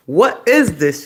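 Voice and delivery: high, very loud mock outrage; retold outraged shout. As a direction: demand it loudly, acting out the shock. Acted argument with child.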